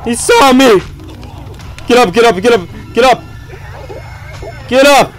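frantic high yelling; panicked shouting. Real, not acted. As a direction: scream at yourself to get up. Caught by monster, panicking.

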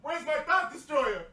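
quiet grumble of a lost item; muttered search. Pretend. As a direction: mumble it while looking around. Mock searching for his item.

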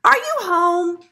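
high, loud calling-out; yelling. A narrator's reading. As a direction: shout to whoever is inside. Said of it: "someone yelled outside".

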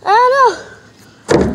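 loud, high startled cry; alarmed. Real, not acted. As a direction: yelp as the barrel gets away. Barrel rolls away unexpectedly.

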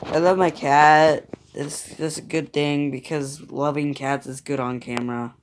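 normal talking voice

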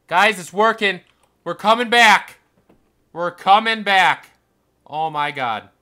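loud, high, elated yells; excited high-pitched celebration; triumphant shouting. Real, not acted. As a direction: shout the comeback to the viewers. Sick count falling, gamer celebrates.

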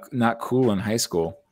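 normal talking voice

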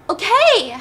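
high, loud acted cry; sobbing scream. Daughter gives in to dad, acted sobbing scream. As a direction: imitate a distraught girl crying out. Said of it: "sobbing, screaming".

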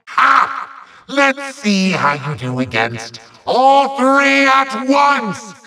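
ordinary delivery

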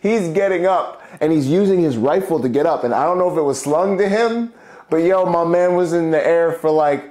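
loud, high, hyped-up storytelling; excited. Real, not acted. To the host about Foster flying, loud and hyped. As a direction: tell it like it's still unbelievable.

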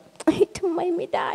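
put-on high, slow whiny child protest; imitating a frustrated kid denied his game; angry child. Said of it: "มีแบบ".

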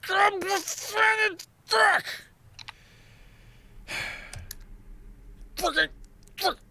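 very high, squeezed frustration; frustrated squeal. Real, not acted. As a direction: squeak out the swearing in anguish. Upset after the failure.

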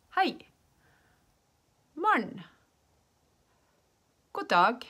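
high, loud model greetings with clear gaps; language-teacher demonstration. Shown as a demonstration. Teacher showing learners ways of saying hi, projected demonstration. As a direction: call out each greeting for learners to copy.

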